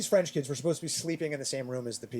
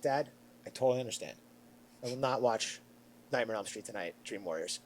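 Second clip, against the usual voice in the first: dutiful promise; 5 semitones lower, 8 dB quieter than usual. Retold or imitated. Acting his promise to dad.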